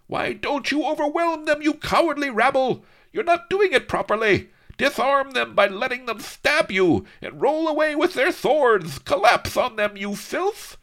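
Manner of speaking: very high, loud frantic villain voice; gibbering villain. A narrator's reading. Don Lardo berating his cowardly men as they fall. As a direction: berate the rabble in a high shriek. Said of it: "He moaned and gibbered".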